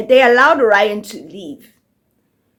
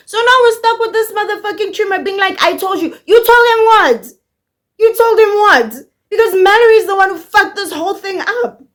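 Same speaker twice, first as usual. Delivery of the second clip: much higher, fast, indignant shouting; outraged rant; 9 semitones higher, 3 dB louder, faster than usual. Real, not acted. Vlogger ranting at Butcher's tumor, shrill outrage. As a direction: rant it fast and high, fed up.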